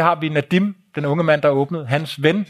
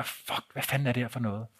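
low, very soft muttering; baffled thought kept under the breath; muttered inner thought; 3 semitones lower, 13 dB quieter than usual. Retold or imitated. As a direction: swear quietly to yourself in disbelief.

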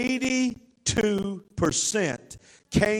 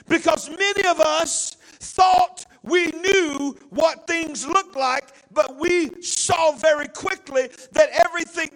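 full-force preaching; 5 semitones higher than usual. Real, not acted. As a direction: preach it out to the whole church.

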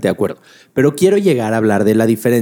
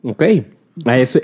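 usual speaking voice

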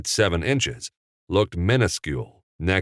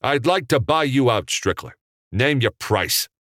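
bold, pitched-up offer; announcing; 7 semitones higher than usual. A narrator's reading. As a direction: declare it like a challenge. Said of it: "Capra announced".

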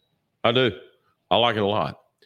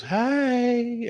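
high retold greeting. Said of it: "And she just goes".

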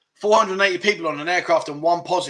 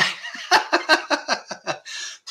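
real burst of laughter; long, high run of chuckles; amused laugh; 11 semitones higher than usual. Real, not acted. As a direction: laugh high at a viewer's joke. Reacts to viewer's Pringles joke.

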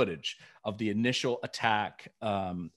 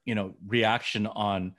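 ordinary talk, nothing marked

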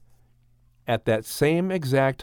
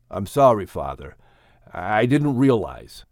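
a son owning up, voice dropping; apologetic; 5 semitones lower than usual. A narrator's reading. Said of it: "feeling bad that he had caused".

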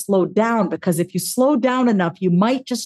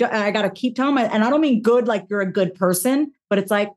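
normal talking voice

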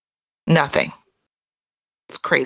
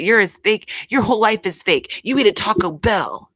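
mocking Mary; 6 semitones higher than usual. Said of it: "Mary said that".

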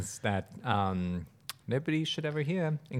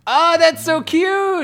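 gushing squeal; 11 dB louder, slower than usual. Real, not acted. Gushing over cute notebook.

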